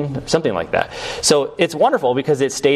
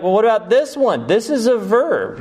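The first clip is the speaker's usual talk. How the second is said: mild, higher-pitched challenge; objecting listener; 9 semitones higher than usual. Acted. Listener challenging the teacher's noun argument, mildly objecting. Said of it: "And you're like".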